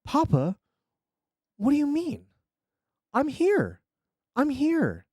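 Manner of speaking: put-on high little-boy voice with a Russian accent; scared, confused child. A narrator's reading. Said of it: "He was a scared, confused child".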